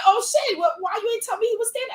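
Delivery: hammed-up surprised acquaintance; startled. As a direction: play him caught off guard, squeaky and rushed. Surprised guy voiced in story.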